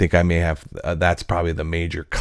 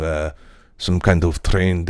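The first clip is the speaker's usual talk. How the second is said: ordinary talk, nothing marked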